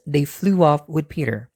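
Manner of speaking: plain reading voice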